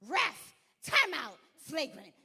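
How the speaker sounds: loud, slow, high mock complaint; milk it, drag out the call for the ref; mock outrage. Coach hamming up a complaint to the ref, loud and slow. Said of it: "And I was like".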